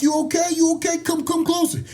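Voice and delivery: imitated referee. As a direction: imitate a ref checking a fighter. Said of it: "The ref like".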